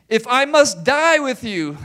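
loud, high, slow pledge; emphatic vow. Retold or imitated. Peter vowing to Jesus, emphatic and loud. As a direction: swear it with everything. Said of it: "he said emphatically".

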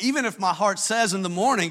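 ordinary talk

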